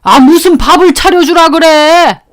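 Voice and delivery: put-on loud, high, exasperated snap of an old father; an irritated old man yelling at his wife; gruff angry outburst. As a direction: bark it back at the wife in annoyance. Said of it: "아버님이 그러시더라고요".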